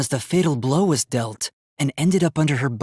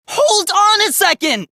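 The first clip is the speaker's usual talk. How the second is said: high, loud, slow shout with HOLD hit hard; shouted demand; 4 dB louder than usual. Acted. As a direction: yell it out, stretching each word. Third demand to be heard.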